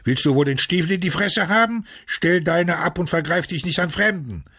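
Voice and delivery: high, threatening yell; irate high-pitched scolding; angry shouting. A narrator's reading. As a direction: yell a warning at a boot thief. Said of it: "musste er schreien".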